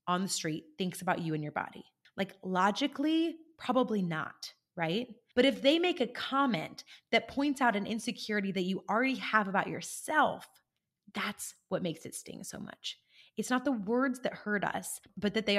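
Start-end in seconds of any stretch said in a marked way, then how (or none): none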